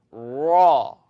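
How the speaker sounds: raw loud with the tongue rolled on r. Shown as a demonstration. Teacher demonstrates a rolled r in raw. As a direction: roll the r hard on raw.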